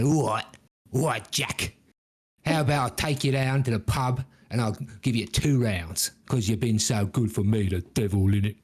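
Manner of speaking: devil with a Cockney twang; English accent. Acted. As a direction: bargain in a put-on English accent. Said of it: "our evil folk have English accents".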